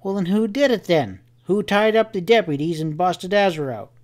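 high-pitched, insistent cowboy questioning; agitated Sam. A narrator's reading. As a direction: press for answers in a high rustic voice.

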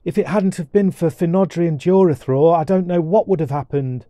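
excited storybook sister; relieved young girl. Acted. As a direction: tell it breathlessly relieved to a brother.

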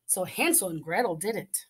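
ordinary delivery, nothing marked

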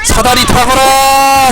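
slow high archaic general's command; loud gung-ho soldier. Pretend. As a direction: bellow the order like an old warlord.

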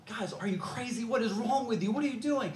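a hint of a shocked homeowner; incredulous outcry. Homeowner finding four guys digging through his roof, incredulous.

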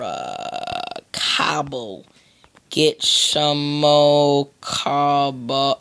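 very slow, stretched syllables; drawn-out words. Pretend. Gamer off to fetch cobble, comically drawn out. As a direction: drag every word right out.

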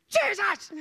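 sudden high-pitched yell; re-enacted scream drowning out a voice. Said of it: "I'm just crying and screaming".

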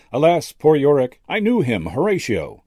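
high, loud theatrical Shakespeare; actor's quotation. A narrator's reading. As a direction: declaim Hamlet like an old stage actor. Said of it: "the old actor continued the quotation".

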